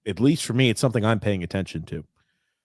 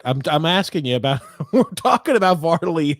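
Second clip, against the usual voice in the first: laughing; 7 semitones higher, 4 dB louder than usual. Real, not acted. Laughing at co-host's dodge.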